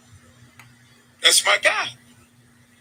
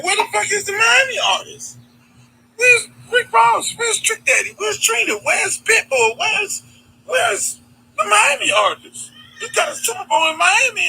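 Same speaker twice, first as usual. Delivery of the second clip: outraged shout; 14 semitones higher than usual. Retold or imitated. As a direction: cry out in disbelief at the snub. Outraged at missing Miami artists.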